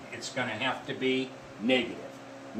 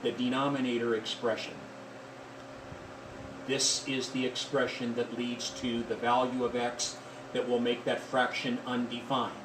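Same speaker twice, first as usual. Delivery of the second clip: softer, lower, carefully measured delivery; careful and slow; 4 semitones lower, 7 dB quieter than usual. Real, not acted. Teacher flagging a key item for students, careful and deliberate. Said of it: "I'll say this carefully and slowly".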